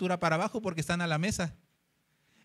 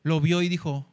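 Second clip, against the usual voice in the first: normal talking voice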